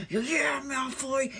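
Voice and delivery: high, strained taunt; mock gloating. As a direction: jeer at your rival as the curse hits. Gloating while torturing a rival by spell.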